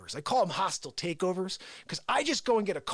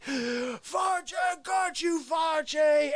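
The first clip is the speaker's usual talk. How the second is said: mock triumphant shout; 7 semitones higher, much slower than usual. Grabbing Face.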